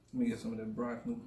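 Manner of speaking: fast mumble through a mouthful; talking while chewing food; mouth full. Real, not acted. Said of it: "I'm talking with my mouth full".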